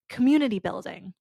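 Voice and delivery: usual speaking voice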